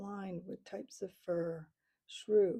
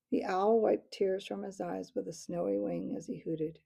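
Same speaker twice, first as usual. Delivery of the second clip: ordinary delivery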